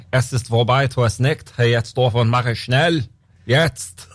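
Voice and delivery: put-on barked lines in a German accent. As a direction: play a stern German soldier. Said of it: "what's your German accent like".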